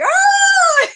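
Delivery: a very high, loud startled "Oh!"; mock gasp of surprise. Bees seeing the sugar water, squealing high in delight. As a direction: squeal it like an amazed bee. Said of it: "You're probably going".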